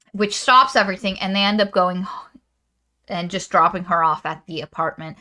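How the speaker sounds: ordinary talk, nothing marked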